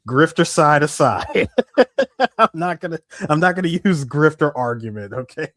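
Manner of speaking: amused laughing. Real, not acted. Co-host disclaiming a grifter argument to his friend, laughing. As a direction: break into laughter while disclaiming.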